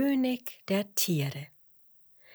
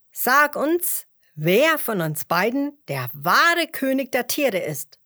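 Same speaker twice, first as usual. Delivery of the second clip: loud, eager demand; calling out; 4 semitones higher, 7 dB louder than usual. A narrator's reading. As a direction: call out the question loudly. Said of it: "riefen Fuchs und Löwe". (laut fordernd gerufen)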